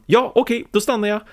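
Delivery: high-pitched, eager-to-obey voice; compliant yes-man. Acted. Acts Carl obeying the guard.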